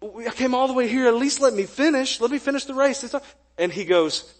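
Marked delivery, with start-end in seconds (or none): 0.0-3.2 pleading runner. Retold or imitated. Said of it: "And I said".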